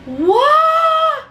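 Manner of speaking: high shouted what; a sudden startled cry of disbelief; shocked what. Retold or imitated. Shocked reaction to husband's stunt.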